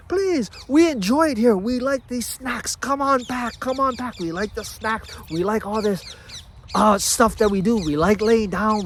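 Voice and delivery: high, wheedling voice coaxing you back; tempting old self. Acted. The abandoned self coaxes you back, high and wheedling. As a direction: lure them back with snacks and lazing. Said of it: "It's gonna do all it can".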